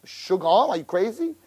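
ordinary talk, nothing marked

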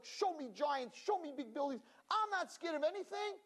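high, eager challenge; bold boast. Acted. Nation ready for Israel, high eager boast. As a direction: dare them to show you giants.

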